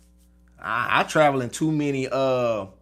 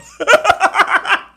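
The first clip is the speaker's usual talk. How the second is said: high laugh, quickly falling away; a sudden high laugh; short real laugh; 9 dB louder than usual. Real, not acted. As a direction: laugh at the flubbed line. Laughs at own bit.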